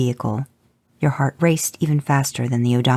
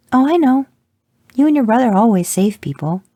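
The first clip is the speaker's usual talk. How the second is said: put-on high, bright-eyed kid voice, louder; excited little girl; 10 semitones higher, 6 dB louder than usual. A narrator's reading. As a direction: gush it like an eager young daughter. Daughter voiced, excited, in story.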